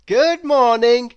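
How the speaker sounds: high, slow, bright-hearted greeting; cheery Mister Happy. Acted.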